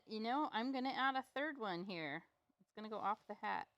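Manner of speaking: ordinary talk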